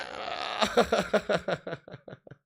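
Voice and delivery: long real laughing fit; helpless laughter. Real, not acted. Streamer seeing what a viewer sent, cracking up. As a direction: burst out and keep laughing.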